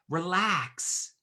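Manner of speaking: drawn-out relax. Retold or imitated. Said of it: "I try to defend, like".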